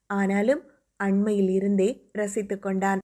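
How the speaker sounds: plain reading voice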